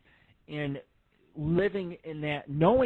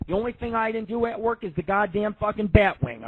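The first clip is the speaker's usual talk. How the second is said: ordinary talk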